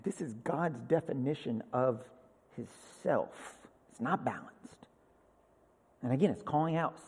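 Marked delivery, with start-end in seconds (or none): 0.0-4.5 low, soft, intense; hushed. Real, not acted. Preacher hushes the room on God's self-definition. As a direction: drop right down and lean in.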